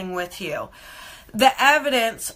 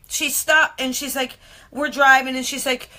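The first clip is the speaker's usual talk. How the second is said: ordinary talk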